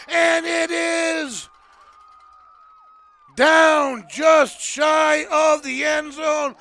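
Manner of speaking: building football call with long stretched words; loud, dramatic touchdown-watch commentary; excited play-by-play. Real, not acted. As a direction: draw out the suspense at the goal line. Live call near the goal line.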